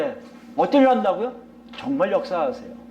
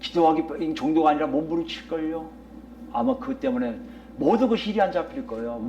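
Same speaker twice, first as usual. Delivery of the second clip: ordinary delivery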